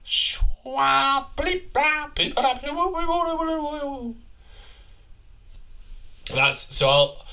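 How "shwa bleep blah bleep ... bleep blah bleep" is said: high, babbling nonsense language; made-up warbling babble, pitched way up; alien gibberish. Acted. Garbled speech before translating it.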